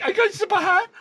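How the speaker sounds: high, laughing nervous reply; laughing squeak. Retold or imitated. Meeting Connery, answering him in a giddy laugh.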